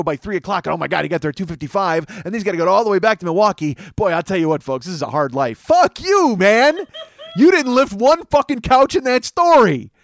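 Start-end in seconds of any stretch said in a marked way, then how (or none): 5.6-9.9 angry rant. Real, not acted. Ranting at the comedian.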